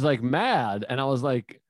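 ordinary talk

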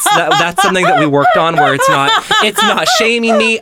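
high, giggly laughing talk. Real, not acted. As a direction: laugh through the words. Laughing at her own story.

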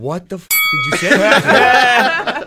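loud, high, drawn-out disbelief; slow, incredulous mock shout; retold outraged yell. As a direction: imitate a furious friend yelling down the phone. Angry friend's phone call retold.

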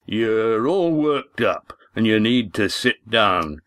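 very slow, calming innkeeper; soothing. Acted. Walt urging agitated Lindy to sit, slow and soothing. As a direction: ease her down gently.

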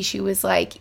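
usual speaking voice

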